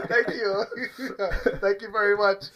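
high, laughing, mock confused; giggling through it. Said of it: "you're like".

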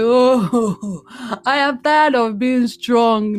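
loud, high, drawn-out wail of weariness; acted tearful outburst. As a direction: re-enact breaking down, worn out. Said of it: "I started crying".

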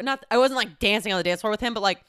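ordinary talk, nothing marked

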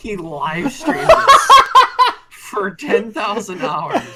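high, slow, laughing disbelief; can barely get it out for laughing. Real, not acted. Laughing at a wild comment.

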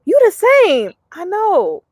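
high-pitched, falling exclamations; high swoops, easing down with each reply; acted gushing exchange. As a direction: play out an amazed greeting, then proudly agree. Acts out meeting fans in person.

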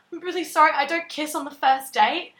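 high, loud, mock-panicked excuse; panicked apology. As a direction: blurt out an imitated panicky apology. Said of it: "I panicked and I was like".

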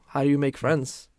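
ordinary talk

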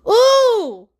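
high, loud startled ooh; a squealed ooh at her own words; sudden ooh. Real, not acted. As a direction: blurt it out in a jump of pitch. Sudden self-aware exclamation.

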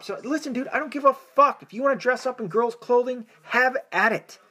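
fired-up podcast rant; heated, dismissive. Real, not acted. Heated rant on gender issues.

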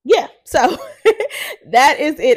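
laughing through the wrap-up. Real, not acted. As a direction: giggle while closing the show. Laughs while wrapping up episode.

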